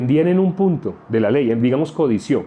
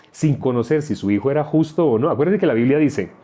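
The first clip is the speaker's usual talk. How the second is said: usual speaking voice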